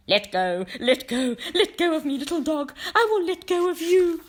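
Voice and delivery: high, loud, desperate begging; pleading stranger. A narrator's reading. Bitten stranger begging the dog, high loud pleading. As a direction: beg in panic, voice climbing.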